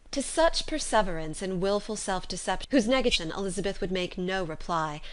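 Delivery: ordinary delivery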